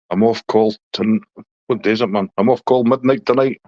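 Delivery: ordinary talk, nothing marked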